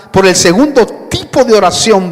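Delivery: ordinary talk, nothing marked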